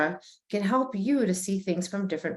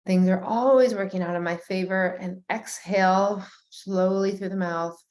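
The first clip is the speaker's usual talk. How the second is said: guided meditation. Acted. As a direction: lead them gently through each breath and feeling. Leads a guided breathing meditation.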